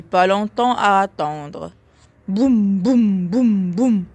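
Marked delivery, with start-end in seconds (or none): 2.3-4.0 «boum» lourds et sonores, martelés; gros sabots. A narrator's reading. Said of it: "firent les gros sabots". (pas lourds qui résonnent)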